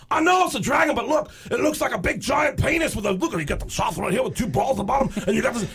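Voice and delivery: hammed-up high rocker rasp; high raspy rocker voice. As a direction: squeal it like a screaming metal singer. [name] voiced in story.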